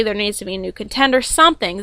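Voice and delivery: usual speaking voice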